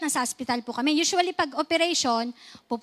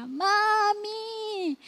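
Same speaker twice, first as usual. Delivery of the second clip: put-on high, drawn-out child's cry for mommy; 7 semitones higher than usual. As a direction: wail like a frightened boy in pain. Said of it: "yun ang sinisigaw niya". (mataas na iyak ng batang naghahanap sa nanay)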